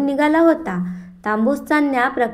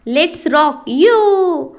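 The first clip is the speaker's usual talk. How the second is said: stretched, excited rallying cry; exuberant whoop; 3 semitones higher, 4 dB louder, much slower than usual. A narrator's reading. Party-goer whoops before setting off.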